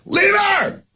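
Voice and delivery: high-pitched shout from a truck; yelled catcall. Retold or imitated. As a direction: holler it across a parking lot. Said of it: "The guy yells out".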